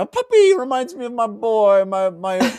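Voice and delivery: high, gushing mock voice for his dog; doting mock Severian. Friend mocks the hero gushing about his dog, high silly voice. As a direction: gush over the puppy in a silly high voice.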